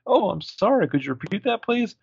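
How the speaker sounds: high, mock-polite receptionist voice; snooty assistant. The assistant brushing off Hannah in a high mock-polite voice. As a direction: fake sweetness on the phone.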